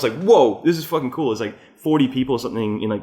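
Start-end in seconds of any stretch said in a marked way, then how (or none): 0.2-1.3 higher, louder retold wonder; mock amazement. Retelling his reaction to the bar joining in, amazed. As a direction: lightly act being thrilled and amazed.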